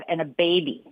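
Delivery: ordinary talk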